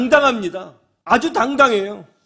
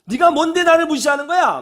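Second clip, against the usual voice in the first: high, loud acted indignation; retold angry quarrel; 8 semitones higher, 6 dB louder than usual. Driver who feels looked down on, angry shouting. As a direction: imitate an offended driver yelling. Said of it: "그러면서 막 싸운 거죠".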